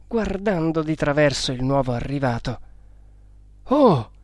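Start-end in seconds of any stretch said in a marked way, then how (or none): 3.6-4.1 high, loud startled cry; frightened exclamation. A narrator's reading. Newcomer seeing a tiger at the hut, frightened. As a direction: yelp in sudden fright. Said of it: "esclamò quello, spaventato".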